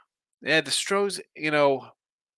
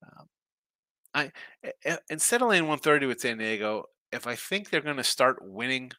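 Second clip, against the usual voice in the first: usual speaking voice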